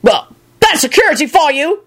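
high-pitched disgusted bark; barked complaint. A narrator's reading. Annoyed warlock to his colleague, barked. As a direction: grumble it out sharply and high. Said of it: "one of them barked at the other".

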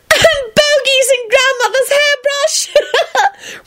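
high, slow words through laughter; squealing with laughter; laughing. Acted. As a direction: giggle through the prank memory. Twins giggling over pranks.

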